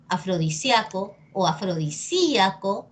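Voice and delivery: afrodi-SIA-co, then afrodi-SÍ-a-co; second afrodisíaco with a drawn, stressed Í; two accepted stresses. Shown as a demonstration. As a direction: show afrodisíaco as diphthong, then hiatus. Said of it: "depende de ti cómo lo quieras decir".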